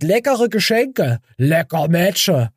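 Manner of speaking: sing-song it like a goofy caption; silly mocking voice.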